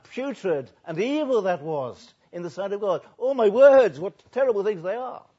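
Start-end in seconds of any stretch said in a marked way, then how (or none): 3.2-5.3 high, very loud cry of dismay; anguished outcry. Acted. Isaiah appalled at his own words, high loud outcry. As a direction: recoil in horror at your own words.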